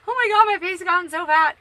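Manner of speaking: high, loud shocked exclaim; real loud dismay at her own face; dismayed outburst. Real, not acted. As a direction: blurt it out in horror at the mirror. Seeing herself on camera.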